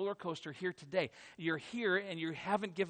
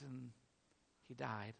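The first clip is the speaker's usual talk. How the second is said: hushed grief; 6 semitones lower, 11 dB quieter, slower than usual. Acted. Witnessing Jesus' death.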